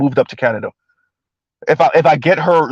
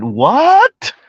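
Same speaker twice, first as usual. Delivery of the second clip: incredulous what; 9 semitones higher than usual. Real, not acted. Reacting incredulously to a clip.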